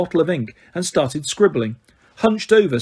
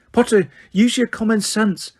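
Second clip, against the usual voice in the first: snapped; 7 semitones higher than usual. A narrator's reading. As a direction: snap back to sternness. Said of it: "snapped Professor McGonagall".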